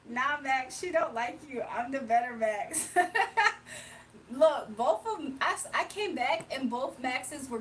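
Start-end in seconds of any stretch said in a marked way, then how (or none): 2.9-4.2 loud laugh, high in pitch. Real, not acted. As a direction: burst out laughing at the teasing. Laughing at better Max joke.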